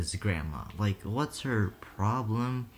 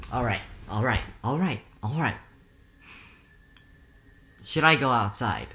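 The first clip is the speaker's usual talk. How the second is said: ordinary talk, nothing marked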